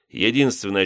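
plain reading voice

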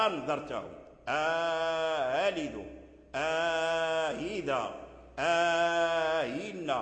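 "أنذرتهم", "آلد", "آهذا", "آهن" with a long held "aaa" before the softened hamza; inserted long alif. Shown as a demonstration. Teacher showing Qalun's "آلد", "آهذا", "آهن", long separating alif. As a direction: hold the opening a of "آلد", "آهذا", "آهن", then glide on. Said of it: "يدخل بينهما ألفا".

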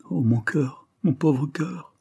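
high, breaking into a whisper; sobbing. A narrator's reading. Daughter sobs over her dying father, whispering. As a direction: let it crack and fade to breath. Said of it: "sanglota la malheureuse enfant".

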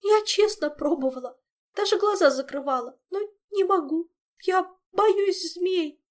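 high, whimpering confession; tearful Zin. Acted. Zin admitting she is afraid of snakes, tearful high wail. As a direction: wail it helplessly, high and loud. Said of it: "всхлипнула Зинь".

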